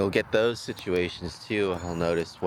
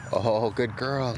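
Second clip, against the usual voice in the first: praising the dog; 4 semitones higher, much slower than usual. Real, not acted.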